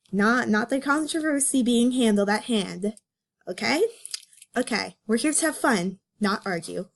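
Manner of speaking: ordinary talk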